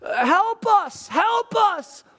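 high, drawn-out cries for help; imitated distant shout. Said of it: "they, and they shout".

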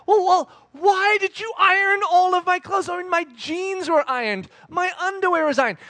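high-pitched, incredulous complaint; hammed-up baffled objection; mock protest. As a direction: demand an explanation from mom. Confronting mom about ironing.

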